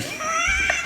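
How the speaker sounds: sudden high shrieking laugh. Real, not acted. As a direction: burst into a squealing laugh. Laughs after crude joke.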